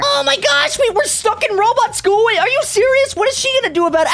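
high-pitched panicked disbelief; alarmed. Acted. Kid stuck in robot school, alarmed. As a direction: play rising alarm at being trapped. Realizes they are trapped.

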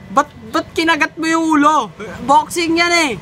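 worked-up high-pitched reaction; incredulous outburst. Real, not acted. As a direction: exclaim in amused disbelief. Outraged reaction to bite in video.

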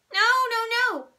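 put-on a boy protesting a spanking; frantic little boy's loud no; shouted no. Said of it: "Fudge shouted".

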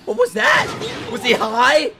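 high, loud, incredulous questions; high-pitched stunned yelling; baffled outcry. Real, not acted. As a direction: ask it in squeaky disbelief. Baffled by the character.

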